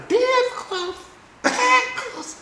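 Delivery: squeezed out very high, a comic choked squeak; strained squeak. Retold or imitated. Telling how close she came to slapping Trevor, strangled high squeak. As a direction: cram the rage into a tiny high-pitched squeak.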